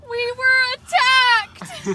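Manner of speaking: shrieked, high and loud; hammed-up panic yell; mock scream. Joking scream after arriving.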